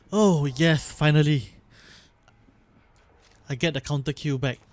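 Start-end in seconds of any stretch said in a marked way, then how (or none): 0.1-1.5 loud, delighted oh yes finally; excited cheer. Real, not acted. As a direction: cheer at getting it back. Gets ability back in game.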